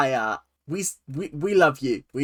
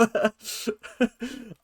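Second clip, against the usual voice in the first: high burst of giggling; amused laugh; 6 semitones higher, 5 dB quieter than usual. Real, not acted. Reviewer laughing at his Southern mom impression, real giggle. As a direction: crack up at your own impression.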